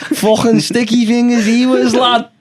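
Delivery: thick put-on Scouse lad, chatty; Scouse accent. Lad telling his pal about last week, in a put-on Scouse accent. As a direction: play a Liverpool lad gossiping about his mate. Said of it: "sing in the Scouse accent".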